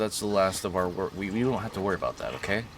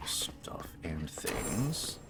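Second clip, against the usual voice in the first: whispered mumble; 4 semitones lower, 9 dB quieter, much slower than usual. Real, not acted. Mumbling to himself.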